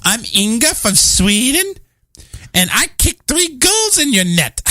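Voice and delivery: mocking Swedish player in a terrible Swedish accent; bad Swedish accent. Host mocks winning Swedish soccer player in a bad Swedish accent. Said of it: "Your Swedish accent is horrible".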